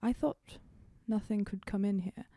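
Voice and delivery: ordinary delivery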